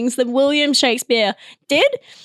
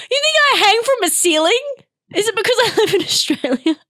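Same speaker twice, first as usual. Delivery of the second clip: mock offended vampire; 8 semitones higher, faster than usual.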